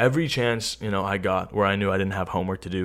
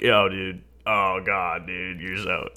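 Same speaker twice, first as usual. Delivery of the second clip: put-on drunk dude voice; slower than usual. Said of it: "why is that exactly the voice that you do".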